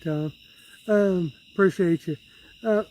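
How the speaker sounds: ordinary talk, nothing marked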